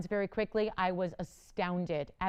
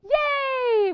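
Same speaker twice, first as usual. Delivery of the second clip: long, very high, loud cheer; big celebratory whoop; delighted cheer; 10 dB louder than usual. Real, not acted. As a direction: squeal it out in delight and hold it. Cheers caller's good news.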